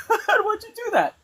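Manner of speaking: high, laughing mock complaint; laughing child's protest. Child asking dad why he let her fall, laughing. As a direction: squeal it through a laugh like a hurt kid. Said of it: "I'm like".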